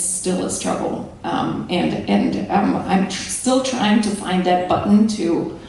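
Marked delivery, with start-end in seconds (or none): none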